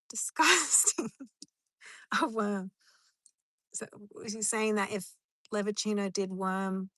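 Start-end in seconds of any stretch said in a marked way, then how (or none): 0.1-2.7 slow, loud, laughing yuck; laughing disgust. Real, not acted. Reacting to viewer's emoji, laughing disgusted. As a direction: drag it out laughing and grossed out.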